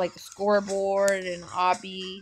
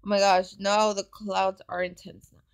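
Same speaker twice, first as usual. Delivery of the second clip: usual speaking voice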